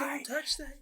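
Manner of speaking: high-pitched mock shout; hammed-up squeaky alarm; Toad. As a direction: echo the cartoon warning in a high squeal. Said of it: "then Toad pops up".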